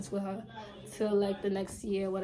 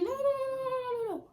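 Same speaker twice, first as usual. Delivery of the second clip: much higher, rapid string of no; panicked brush-off; mock frantic denial; much faster than usual. Candy dodges the question, frantic squealing denial. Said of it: "Candy was like".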